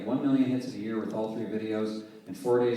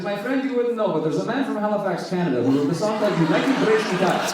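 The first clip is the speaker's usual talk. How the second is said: higher, eager and laughing, a lightly voiced Eastern European accent; a cabbie thrilled to share the story; the Romanian driver telling his passenger, lightly accented and eager; excited Eastern European driver; 9 semitones higher, 6 dB louder than usual. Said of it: "he had this really thick Eastern European accent".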